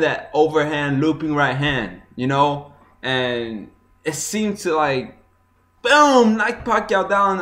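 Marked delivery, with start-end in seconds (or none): 5.8-6.4 sudden high loud "boom" for a landed punch. Retold or imitated. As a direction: bark out the punch sound. Punch landing, knockdown moment.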